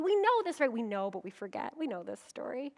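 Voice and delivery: ordinary delivery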